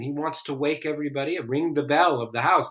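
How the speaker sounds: usual speaking voice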